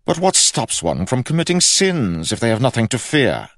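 high, loud, indignant challenge; worked-up king. A narrator's reading. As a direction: demand it as an affronted king. George's complexion was turning ruddy.

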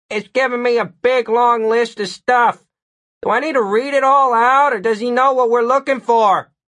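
calling from afar. A narrator's reading. Partner asking about a long list, calling out. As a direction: call out as if from down a hall. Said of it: "Murphy's distant voice called out".

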